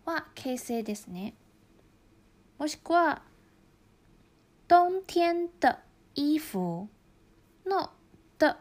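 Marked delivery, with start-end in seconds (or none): 4.7-5.8 冬天的 said slowly, 的 short and light; 冬天的 spelled out slowly with a clipped light 的; slow tone demo. Shown as a demonstration. Teacher demonstrating the neutral tone, slow. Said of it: "的は形声です".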